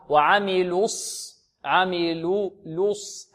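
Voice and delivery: وعملوا الص slowly, "lu" running straight into sad; dropped waw. Shown as a demonstration. Teacher showing how the waw drops, dropped waw. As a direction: stretch the syllables, close عملوا on "lus". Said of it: "هذه الواو تسقط وصلًا".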